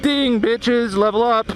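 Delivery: excited game announcer. Retold or imitated. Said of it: "and I was like".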